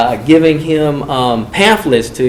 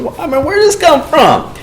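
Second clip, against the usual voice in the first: surprised question; 13 semitones higher than usual. Retold or imitated. Surprised at the sudden change.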